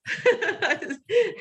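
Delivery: a high burst of laughter. Real, not acted. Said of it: "I started laughing".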